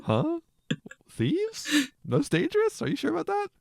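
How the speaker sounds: high-pitched incredulous questioning; mock disbelief. Host mocking the movie line about dangerous convicts, in high-pitched disbelief.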